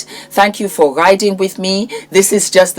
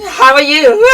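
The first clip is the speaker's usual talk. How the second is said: very high, louder laughing greeting; squealing laugh; 5 dB louder than usual. Real, not acted. Vlogger squealing hello to her fans.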